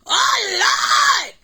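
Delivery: imitated high-pitched scream of overwhelm; mimicking a shrieked exclamation. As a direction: scream it out like an overcome fan. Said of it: "She's screaming".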